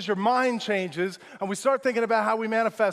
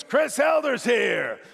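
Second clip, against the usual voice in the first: loud, higher, drawn-out announcement; raised voice; 4 semitones higher, 4 dB louder, much slower than usual. Retold or imitated. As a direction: call it out slowly to the whole room. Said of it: "this guy raised his voice".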